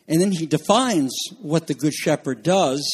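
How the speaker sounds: usual speaking voice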